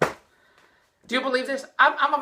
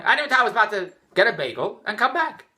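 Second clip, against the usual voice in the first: ordinary talk, nothing marked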